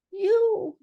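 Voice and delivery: high squeaked word. A narrator's reading. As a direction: squeak it up from below. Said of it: "squeaked Piglet".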